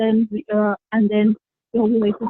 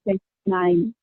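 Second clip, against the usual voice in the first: normal talking voice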